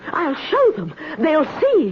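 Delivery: high, tearful defiance. Acted. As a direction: fight back the tears and threaten. Miss Thrip upset, near tears.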